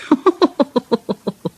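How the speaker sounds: high hooting giggle; gleeful staccato laugh; chuckle. A narrator's reading. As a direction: titter rapidly in a high voice. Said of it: "Thomas chuckled".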